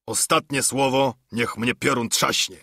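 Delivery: ordinary delivery, nothing marked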